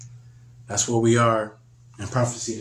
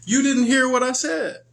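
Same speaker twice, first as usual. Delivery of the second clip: high, insistent parent scolding; 13 semitones higher than usual. Retold or imitated. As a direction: hammer the child for not listening. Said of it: "They be like".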